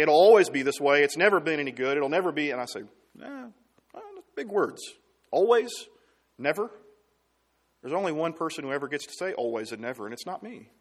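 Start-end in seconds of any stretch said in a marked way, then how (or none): 0.0-2.5 a hint of a despairing counselee, louder; quoting a hopeless person. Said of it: "When they say". 3.2-6.7 scoffing, high and pitched up; mocking scoff. Pastor mocks the words always and never.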